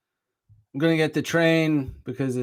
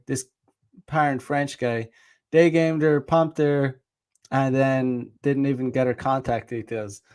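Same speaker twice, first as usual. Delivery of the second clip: usual speaking voice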